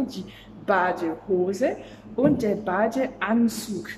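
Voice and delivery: "das Schwimmbecken" drawn out slowly and clearly; teacher modelling a German word slowly; slow vocabulary demo. Shown as a demonstration. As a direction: present the new word slowly to the class. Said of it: "Je vais t'apprendre du vocabulaire".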